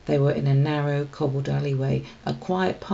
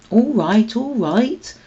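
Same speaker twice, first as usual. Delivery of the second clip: flustered, brushing it off; mildly embarrassed deflection; 6 semitones higher, 5 dB louder, slower than usual. Acted. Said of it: "Holly sounded embarrassed".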